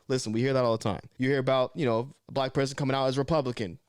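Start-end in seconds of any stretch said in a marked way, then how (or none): none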